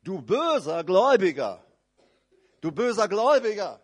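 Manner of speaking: loud stern rebuke; the master scolds his servant loudly; lord rebuking. Acted. Herr tadelt den bösen Gläubiger, laut. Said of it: "Und der Herr sagt".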